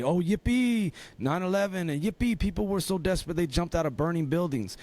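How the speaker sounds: high sarcastic mock celebration; mock cheer. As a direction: sneer it out as fake glee.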